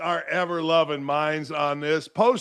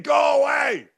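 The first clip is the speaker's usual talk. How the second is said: yelling at clouds; 6 semitones higher than usual. Retold or imitated. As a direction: shout it up at the clouds, grumpy. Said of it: "looking right at those clouds and saying".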